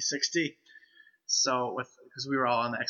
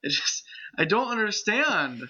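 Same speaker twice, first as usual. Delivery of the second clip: mock distress; 8 semitones higher than usual. Mock upset about voice changing.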